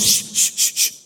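unvoiced shush; hushing. Devil hushing his imps near the house. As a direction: shush them like a sneaking devil. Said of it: "He goes".

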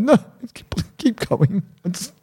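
high-pitched mock sobbing plea; imitated crying. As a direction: act out pleading through tears, words breaking up. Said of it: "couldn't stop myself from crying".

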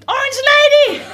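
high, loud laughing shout; giddy high shout. Retold or imitated. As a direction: yell it into his face. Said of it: "So I just shouted".

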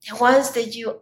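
ordinary talk, nothing marked

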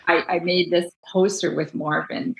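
ordinary talk, nothing marked

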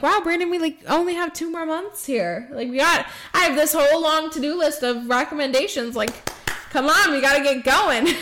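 telling Brandon to get going, eagerly; excited urging. Retold or imitated. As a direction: hurry him along, laughing by the end.

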